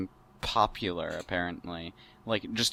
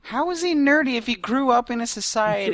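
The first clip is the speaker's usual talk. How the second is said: high, loud disbelief; incredulous; 8 dB louder than usual. Real, not acted. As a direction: shout it in total disbelief. Incredulous outburst.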